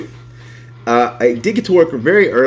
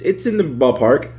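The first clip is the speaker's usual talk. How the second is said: ordinary talk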